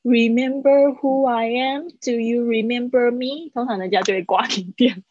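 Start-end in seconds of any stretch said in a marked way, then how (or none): 0.0-3.5 slow vague reminder. Shown as a demonstration. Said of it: "你千万不要说".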